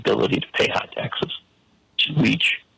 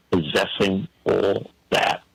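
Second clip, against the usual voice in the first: ordinary talk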